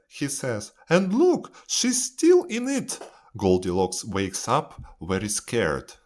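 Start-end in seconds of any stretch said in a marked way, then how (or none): 0.9-3.0 put-on high, loud, excited baby bear; tiny bear's high-pitched discovery. A narrator's reading. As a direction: squeak it out as a little bear. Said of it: "Baby Bear looks at his bed".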